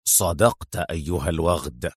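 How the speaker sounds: cold severe confirmation; harsh sternness. A narrator's reading. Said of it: "وتغير صوته ... في صرامة قاسية".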